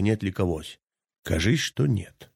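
plain reading voice

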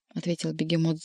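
plain reading voice

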